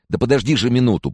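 high, exasperated interruption. A narrator's reading. Student stops his friend talking, raising his voice. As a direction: raise your voice to cut him off. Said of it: "повысил я голос".

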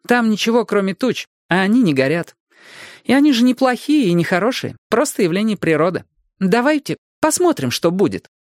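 usual speaking voice

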